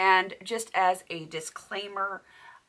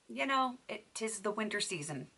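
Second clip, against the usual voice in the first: ordinary talk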